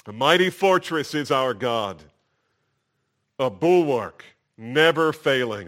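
loud, ringing reading of the hymn lines; loud preacher reading a hymn aloud. Shown as a demonstration. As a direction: proclaim each line like a hymn. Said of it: "let me just read some of the lyrics to you".

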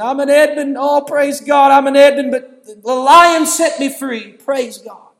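high, loud overjoyed cry of praise; ecstatic crying out. Retold or imitated. Preacher reliving his joy at the movie, loud and high. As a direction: shout it out, overwhelmed with joy. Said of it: "And I'm just crying".

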